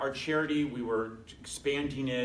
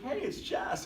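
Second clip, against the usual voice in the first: imitating producer; 12 semitones higher, slower than usual. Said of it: "she's like".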